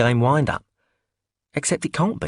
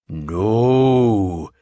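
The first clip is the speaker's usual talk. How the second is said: deep masculine voice; 4 dB louder than usual. Acted. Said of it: "intoned, in her deep, masculine-sounding voice".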